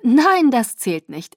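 loud, slow, drawn-out NEIN; protesting. A narrator's reading. Bella objects to her nineteenth birthday, protesting. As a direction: object loudly, stretching out the words.

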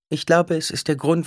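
Duri's voice. A narrator's reading. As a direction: tell the dwarf gravely.

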